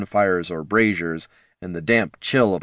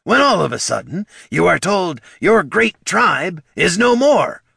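much higher and louder, building to a cry; dramatic orc pitch; 11 semitones higher, 6 dB louder than usual. A narrator's reading. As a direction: sell the tragedy with big drama.

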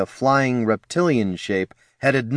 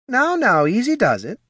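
soothing; 13 semitones higher than usual. Acted. Barkeep soothing a scared eagle.

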